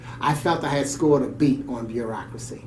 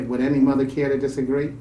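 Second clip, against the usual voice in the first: usual speaking voice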